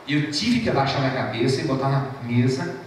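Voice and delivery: usual speaking voice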